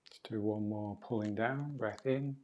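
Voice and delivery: soft, unhurried movement-class voice; guided breathing exercise. Real, not acted. Teacher guiding class to breathe in and out, soft guidance. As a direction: lead them quietly through each breath.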